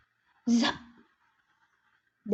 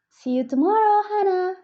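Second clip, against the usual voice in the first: calling goodbye; 8 semitones higher, 5 dB louder, faster than usual. Acted.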